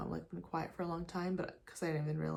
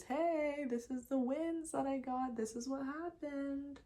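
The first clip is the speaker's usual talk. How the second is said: mock bragging; 7 semitones higher than usual. Mock bragging future self.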